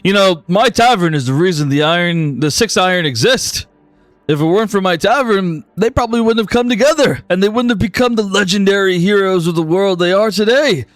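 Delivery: proud tavern owner. Acted. Hogan boasting to the players about his tavern, smug. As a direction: sell the tavern with pride.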